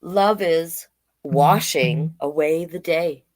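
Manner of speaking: slow, sing-song picture-book read; reading to children. Acted. Drag queen reading a picture book to kids, slow and sing-song. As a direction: read each page slowly to the little ones.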